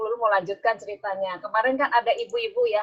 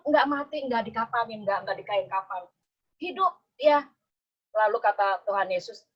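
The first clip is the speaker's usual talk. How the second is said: ordinary delivery, nothing marked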